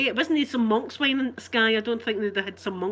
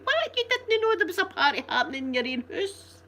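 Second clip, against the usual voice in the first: high, incredulous mocking question; mock disbelief; 8 semitones higher than usual. Mocking Boris for missing a party in his own house, incredulous. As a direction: act amazed at the excuse.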